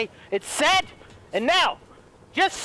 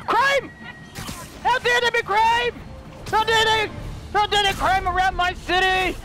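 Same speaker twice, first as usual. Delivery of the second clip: mock hero outrage; 7 semitones higher than usual. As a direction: overplay the outraged superhero.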